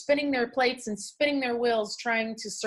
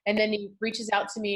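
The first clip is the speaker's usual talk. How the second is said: ordinary talk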